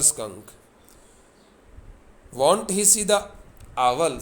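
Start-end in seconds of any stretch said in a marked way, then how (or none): 2.3-4.2 put-on raised, louder voice for a little girl's question; imitating a child asking, pitched up; high child's question. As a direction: read the girl's question high and loud, dropping at the end. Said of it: "She asked in a high and faintly roughened voice".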